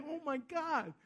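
soft, under-the-breath reaction; retold quiet aside to oneself; hushed disbelief. Onlooker watching the pastor at work, hushed disbelief.

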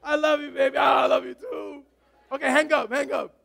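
put-on lovestruck teen couple on the phone; giggly late night call. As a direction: coo and tease, neither hangs up. Couple saying goodnight on phone.

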